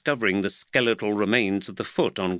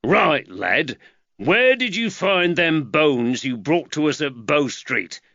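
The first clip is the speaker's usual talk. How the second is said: gruff constable; 5 semitones higher, 4 dB louder than usual. Acted. Constable confronts boy.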